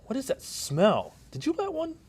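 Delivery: hammed-up disgust in a mock drama; disgusted surprise. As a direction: recoil at the smell. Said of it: "And scene".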